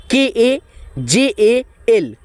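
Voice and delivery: slow, letter by letter; slow spelling. Shown as a demonstration. Teacher spelling a name for students, slowly letter by letter. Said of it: "teacher spells letter by letter".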